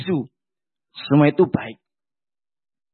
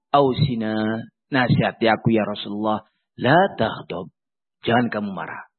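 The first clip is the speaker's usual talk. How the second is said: low, soft pleading voice, then a low, softer answer; pleading petitioner; 9 semitones lower, 3 dB quieter than usual. Acted. Man begs the Prophet again, low and humble.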